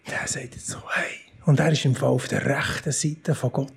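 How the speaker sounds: hushed conspiratorial whisper; whispered to the congregation as a shocking secret. Acted. Secretive aside about Stephen's claim.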